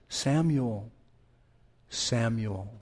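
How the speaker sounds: soft, low call of a name; tender, endearing call. Acted. God calling the boy Samuel, tender and endearing. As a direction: call the name gently and lovingly, hushed. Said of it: "Tender, endearing".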